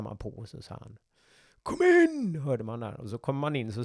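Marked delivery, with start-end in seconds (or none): 1.6-2.4 high, loud shout through a door; called command. Retold or imitated. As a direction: bark it from behind the door. Captain calling through the door.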